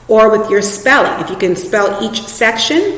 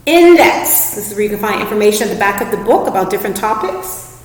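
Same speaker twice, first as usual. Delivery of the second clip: usual speaking voice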